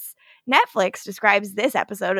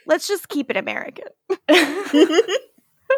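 laughing; 5 semitones higher, slower than usual. Real, not acted. Co-host letting the reader off the accent, laughing. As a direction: toss it off through a laugh.

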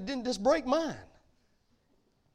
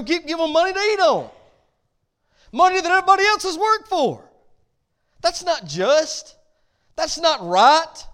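louder and higher, hammering each line; preaching at full force; 6 semitones higher, 7 dB louder than usual. Real, not acted. Pastor to church about leaders, full-force louder preaching. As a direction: thunder it from the pulpit, slowing on the nots.

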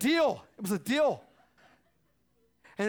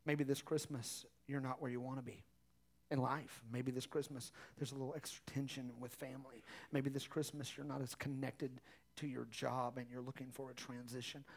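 softer, earnest preaching voice; gentle sermon close; 8 semitones lower, 11 dB quieter than usual. Acted. Pastor encouraging the congregation at Christmas, soft and earnest.

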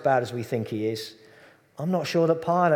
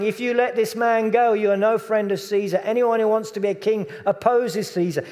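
higher, louder mob cries; crowd shouting; 8 semitones higher, 6 dB louder than usual. Acted. The crowd shouting at Pilate about Caesar, loud and high. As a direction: hurl threats at the governor. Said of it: "the people kept shouting".

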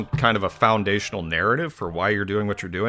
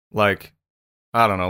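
normal talking voice

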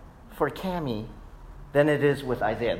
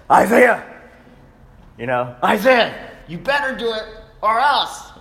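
loud stern warning to a son; raised stern voice; 6 semitones higher, 5 dB louder than usual. Retold or imitated. Father warning his son at church, loud and stern. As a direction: call him out like a firm father. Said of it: "I kinda raise my voice and get that deep".

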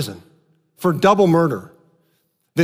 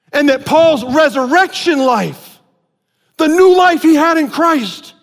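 preaching at full force; 6 semitones higher than usual. Acted. Pastor driving home Paul's freedom from his past to the church, full-force preaching. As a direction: build it up like a revival preacher.

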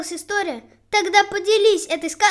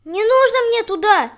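loud, higher sudden cry; crow shouting; 5 semitones higher, 5 dB louder than usual. A narrator's reading. Crow suddenly shouts back at the sparrow. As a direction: burst out shouting in refusal. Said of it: "неожиданно закричала ворона".

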